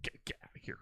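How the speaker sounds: hushed unvoiced shoo; mock whisper. Co-host whispers to shoo off an imagined intruder. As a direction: whisper it like shooing someone off.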